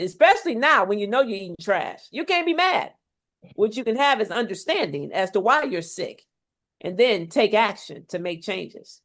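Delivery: ordinary delivery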